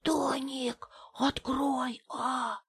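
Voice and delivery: soft, breathy hissed whisper; hissing whisper. Acted. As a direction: whisper it through a keyhole. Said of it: "свистящим шёпотом".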